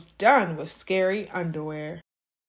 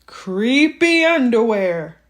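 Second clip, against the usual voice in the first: spooky storyteller voice; 8 dB louder than usual. A narrator's reading. Spooky read of title phrase.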